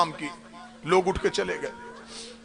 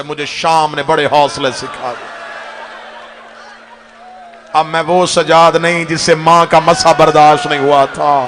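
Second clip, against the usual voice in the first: louder, building outcry; loud climactic majlis cry; rising preaching shout; 3 semitones lower, 8 dB louder than usual. Acted.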